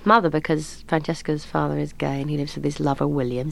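ordinary talk

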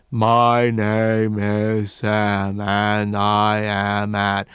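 very slow, mouth wide open; open-mouthed chest voice. Shown as a demonstration. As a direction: drop the jaw and speak from the chest. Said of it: "the most open-mouthed posture type of speech".

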